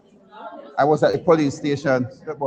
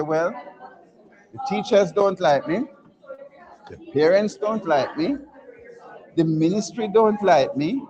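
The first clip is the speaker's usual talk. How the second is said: higher voice, each grievance rising at the end; mock sulky complaint; 5 semitones higher, slower than usual. Man telling mother why he won't go, mock-sulky complaint. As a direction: list the grievances slowly, like a sulking child. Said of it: "gentleman who was in his bed and said to his mo-, mother".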